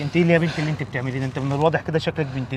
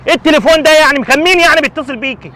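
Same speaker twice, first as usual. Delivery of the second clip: loud, high berating; angry scolding; 10 semitones higher, 10 dB louder than usual. Acted. Father berating daughter over lost money, loud. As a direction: tear into her carelessness.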